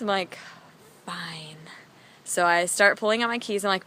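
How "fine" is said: whispered, reluctant giving-in; grudging surrender. Retold or imitated. Storyteller gives in to the voice in her head, grudging whisper. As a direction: give in under your breath.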